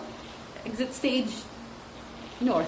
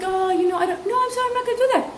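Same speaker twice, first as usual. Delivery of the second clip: mock teachers; 9 semitones higher, faster than usual. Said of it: "they're like".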